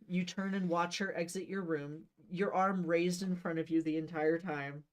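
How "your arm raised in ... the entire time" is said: ordinary delivery, nothing marked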